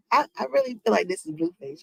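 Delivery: normal talking voice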